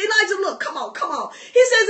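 ordinary talk

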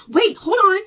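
imitating a flustered professor jumping in; a startled professor cutting in; startled mock cry. Professor voiced in retold arrest.

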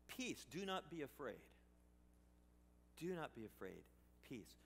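soft, calming word of peace; soft-spoken reassurance from God; gentle reassurance. Acted. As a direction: settle a frightened man gently.

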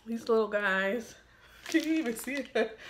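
normal talking voice

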